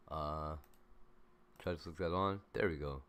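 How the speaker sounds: ordinary talk, nothing marked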